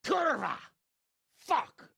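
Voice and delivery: high, loud angry swearing; furious curse. Acted. Lord cursing on hearing the coins are worthless, furious. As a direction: spit out the curses in rage.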